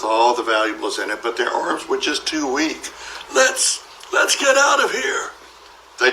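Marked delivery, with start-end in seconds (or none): 3.3-5.3 high, choking, stuttering shout; panicked shout. Acted. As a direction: gasp it out in terror. Choking burglars flee.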